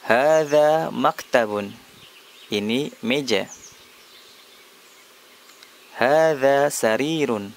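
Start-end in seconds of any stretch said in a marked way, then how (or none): 0.1-1.8 slow, careful Arabic, hadza stressed; slow model phrase. Shown as a demonstration. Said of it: "Hadza, ini". 6.0-7.5 slow, careful Arabic, hadza stressed; slow model phrase. Shown as a demonstration. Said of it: "Hadza, ini".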